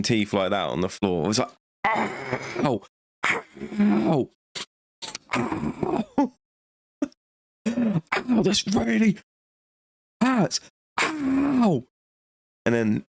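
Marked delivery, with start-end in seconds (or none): 1.9-11.8 squealing "Ow!" climbing ever higher; hammed-up old man howling in pain; whiny high-pitched cries of hurt; high mock yelps of pain. Old man breaks his leg.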